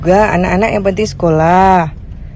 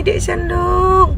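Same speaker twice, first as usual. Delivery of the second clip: a tired mother dragging out her son's name, once more; exasperated drawn-out call; 7 semitones higher, much slower than usual. Real, not acted. Mami calling her slow son, long and exasperated. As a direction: stretch the name out slowly, fed up. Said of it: "menguji tingkat kesabaran Mami".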